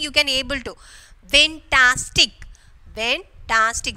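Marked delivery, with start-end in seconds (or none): 1.3-2.3 louder, slow "Fantastic" in three beats; split into syllables. Shown as a demonstration. Teacher showing students pronouncing by syllables, "Fantastic" firmly split. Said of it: "When you are pronouncing". 2.9-4.0 "Fantastic" slowly divided in three; three divisions. Shown as a demonstration. Teacher counting three divisions, "Fantastic" said syllable by syllable. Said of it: "So three division".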